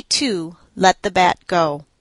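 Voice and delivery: usual speaking voice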